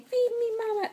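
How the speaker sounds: high pretend baby voice; baby's voice. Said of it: "She's saying".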